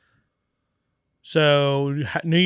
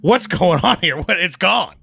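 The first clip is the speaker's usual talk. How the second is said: retold panic, louder and higher; freaking out; 4 semitones higher, 5 dB louder than usual. Brewer phones his partner about the stolen trailer, freaking out. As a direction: re-enact the alarm with raised pitch and volume. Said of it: "I'm freaking out, right?".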